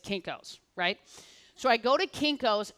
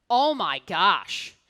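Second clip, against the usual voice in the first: slow, drawn-out oh my gosh, a bit louder; stunned gasp; 3 semitones lower, 5 dB louder, much slower than usual. Retold or imitated. Manager stunned to see her back with the box, slow and amazed. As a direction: let it hang in disbelief.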